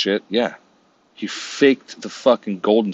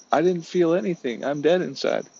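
much higher put-on voice; hammed-up deadpan misery; mock celebrity; 10 semitones higher than usual. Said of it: "She's like".

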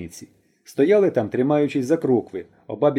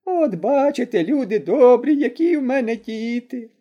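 high, wailing granny lament; complaining old woman; 9 semitones higher, slower than usual. A narrator's reading. Granny complains to onlookers about her daughters, high and wailing. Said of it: "забідкалась баба Мокрина".

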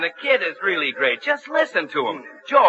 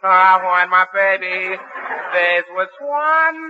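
slow, drawn-out showman croon; much slower than usual. Retold or imitated. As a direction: stretch out the last words like a bandleader. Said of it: "his imitation of [name]".

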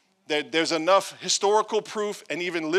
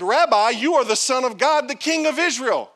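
much higher, louder awe-struck cry; exclaimed; 7 semitones higher, 7 dB louder than usual. Acted. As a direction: blurt out sudden belief. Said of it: "Then Nathanael exclaimed".

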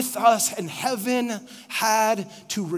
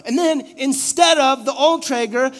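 ordinary delivery

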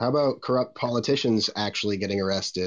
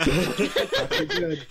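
laughing; much slower than usual. Real, not acted. Laughing at own joke.

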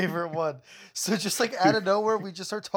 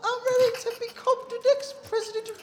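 Dobby voice. Acted. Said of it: "we have Dobby".

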